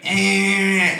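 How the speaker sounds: high, drawn-out mock baby fuss; imitated baby whine. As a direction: whine back like a cranky baby. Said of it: "And she like".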